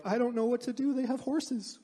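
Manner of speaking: high-pitched whimper mimicking fretful Israelites; mock panicked fit. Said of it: "They're throwing a fit".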